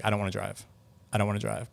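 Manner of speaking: soft, subdued imitation of refusal; retold freaked-out reluctance. Imitating gamers after one scary ride, subdued and reluctant. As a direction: mimic a spooked rider backing out quietly. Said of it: "Freaked out by it".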